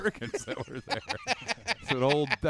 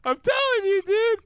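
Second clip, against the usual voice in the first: excited insisting; 5 dB louder than usual. Real, not acted. Insisting loudly.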